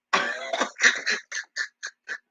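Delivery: high squeaky giggle; high breathy laugh. Real, not acted. Laughing at her joke about God, squeaky. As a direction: let a high laugh escape.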